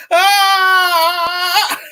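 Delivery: sharp loud yelp of pain; stubbed toe cry. Shown as a demonstration. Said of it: "that's the sound I make when I stub my toe".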